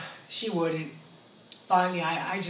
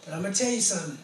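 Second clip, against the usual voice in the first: ordinary talk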